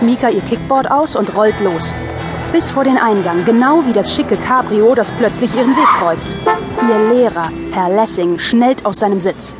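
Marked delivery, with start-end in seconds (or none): none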